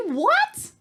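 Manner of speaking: high-pitched disbelieving "What?"; shocked squeal. Real, not acted. Stunned reaction to fact.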